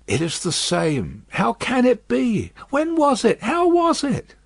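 lightly voiced old man, rising in wonder; a dazed captive groping at memory; hint of a confused old father; bewildered old prisoner. A narrator's reading.

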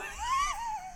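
squeaky breathless laugh; high wheezing laugh. Real, not acted. Vlogger to viewers, wheezing laugh.

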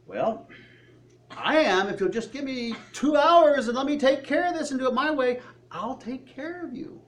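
a hint of God reassuring his people; God's reassuring answer. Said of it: "And God's up there going".

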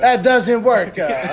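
put-on laughing, half-hearted Italian accent; mock Italian accent. Reluctant friend attempting the requested voice, in half-hearted Italian accent. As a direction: tack an Italian "uh" onto the end. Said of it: "your best Italian voice".

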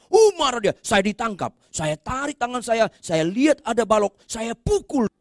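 high-pitched, excited storytelling from the pulpit; heated preaching. Real, not acted. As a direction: race through the action at a high pitch. Preaches at full force.

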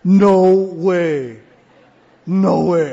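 loud incredulous no way; stunned disbelief. Retold or imitated. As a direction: cry out as if you can't believe what you read. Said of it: "I said".

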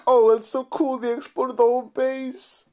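high-pitched mock fanboy gushing; put-on squeal of hype. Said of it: "I bet you were hyped".

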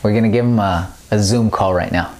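ordinary talk, nothing marked

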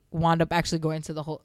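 normal talking voice

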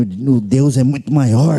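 low, slow laughing declaration; mock solemn. Comedian defying the devil, low slow half-laughing declaration.

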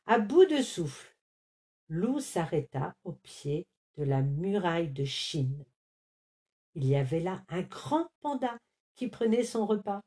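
plain reading voice

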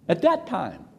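usual speaking voice

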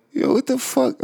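high, incredulous yo; shocked inner reaction. Retold or imitated. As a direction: pitch shoots way up on a stunned what the fuck. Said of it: "I'm sitting there and I'm like".

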